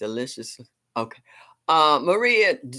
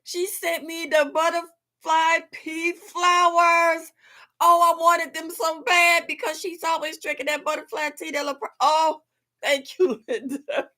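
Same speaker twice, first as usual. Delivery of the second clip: much higher, thrilled near tears; squealing with delight at the seeds; overjoyed; 12 semitones higher than usual. Real, not acted. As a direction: gush over the gift, voice soaring. Opening seed packets from a friend.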